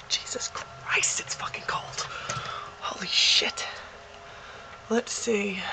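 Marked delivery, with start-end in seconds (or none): 0.1-3.7 hushed unvoiced cursing; whispered swearing. Real, not acted. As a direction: curse under your breath. Swearing at the cold outside.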